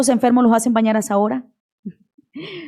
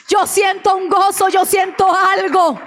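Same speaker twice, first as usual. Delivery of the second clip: raised, ringing retelling of the father's joy; joyful cry; 7 semitones higher than usual. Retold or imitated. Daughter retelling her dying father's joyful cry, raised and exultant. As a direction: call it out high, overflowing with joy.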